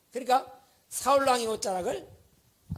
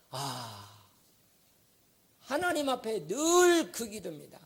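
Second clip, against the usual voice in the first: ordinary delivery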